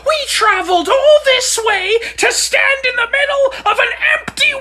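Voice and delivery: high, loud sarcastic shout; furious sarcastic rant, slowing at the end; exasperated yelling. Acted. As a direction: explode at the guide's useless trip.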